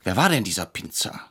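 ordinary delivery

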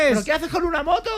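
high-pitched, rapid mock indignation; baffled inquisitors. Dreamer plays inquisitors spotting his motorbike, high and baffled. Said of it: "los de la Inquisición diciendo".

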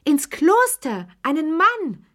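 very high, loud, slow shriek; old lady scandalised, shrieking; shrieking outrage. A narrator's reading. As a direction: squeal it in scandalised disbelief. Shocked outcry continues.

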